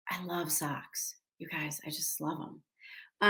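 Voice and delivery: very soft, low near-whisper; hushed confession. Real, not acted. Actress confesses to fans she loves socks, in a hushed whisper.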